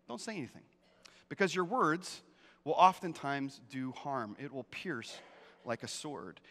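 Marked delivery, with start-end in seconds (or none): 0.1-0.6 softer, "dont" leaned on with a falling close; hushed repeat. Shown as a demonstration. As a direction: drop to a near hush on "dont". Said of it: "then don't say anything".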